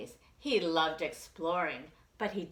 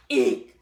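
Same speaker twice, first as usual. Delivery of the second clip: squeak; 5 semitones lower, 13 dB louder than usual. Acted. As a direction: squeak in fright. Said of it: "squeaked the bear".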